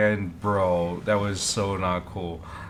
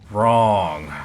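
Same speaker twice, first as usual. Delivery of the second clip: emphatic; 9 dB louder than usual. Real, not acted. Emphatic dismissal of own question.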